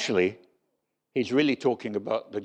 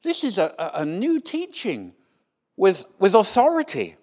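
high, incredulous crowd voice rising to a louder finish; astonished crowd; 7 semitones higher, 4 dB louder than usual. Retold or imitated. Preacher voicing the amazed synagogue crowd, high and incredulous. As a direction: play the amazed onlookers asking what this is. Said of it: "people muttered to themselves".

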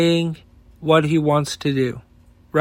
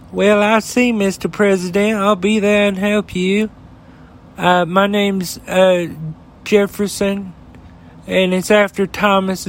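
admittedly bad Southern accent; an amateur's Southern accent, a little up in pitch; Jeff Sessions Southern accent; 3 semitones higher than usual. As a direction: put on a clumsy Southern accent, playing a loyal yes-man. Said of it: "my South accent is horrible".

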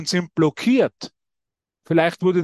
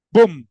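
loud mock boom like a boxer's blow; punch sound; 8 dB louder than usual. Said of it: "wie so ein Boxer schlägt er zu". (lautes Bumm wie ein Boxschlag)

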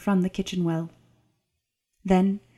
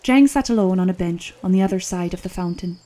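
ordinary delivery

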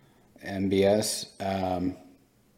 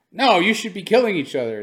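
absurd mock quote; 9 semitones higher, 6 dB louder than usual.

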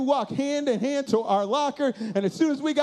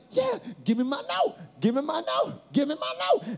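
eager demand; 5 semitones higher than usual. Acted. Calling for note down the row.

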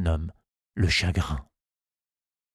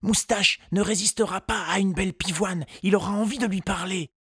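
put-on high, light boy's voice; child voice; 11 semitones higher than usual. A narrator's reading. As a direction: voice a hopeful little boy. Tistou's thoughts voiced.

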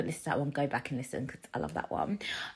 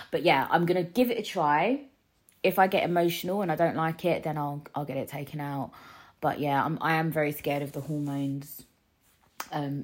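ordinary talk

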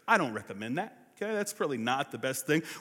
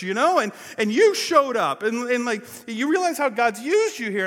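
insistent wife; 6 semitones higher, 8 dB louder than usual. Retold or imitated. Wife voiced, insisting on his success.

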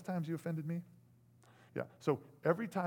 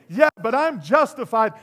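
smug self-justification; 7 semitones higher, 15 dB louder than usual. Acted.

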